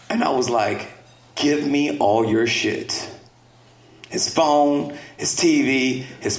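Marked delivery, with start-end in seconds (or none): 1.3-3.1 slow, deliberate stern dad command; slow, heavy dad order; stern slow demand. Retold or imitated. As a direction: lay it down slowly, no nonsense.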